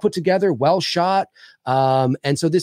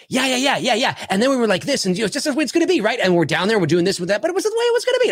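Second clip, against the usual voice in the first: higher, rapid-fire mimicked chatter; hammed-up hyper interviewee, much higher pitch; hyper talker impression; 10 semitones higher, faster than usual. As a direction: imitate two overexcited brothers babbling. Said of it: "It really does sound like this".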